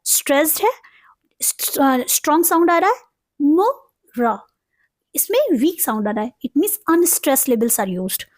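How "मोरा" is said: "मोरा" stretched slowly, मो and रा apart; slow, separated "मोरा", falling away on रा; syllable-by-syllable demo. Shown as a demonstration. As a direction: pause between the syllables of "मोरा". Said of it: "किस तरह से प्रोनाउंस करेंगे".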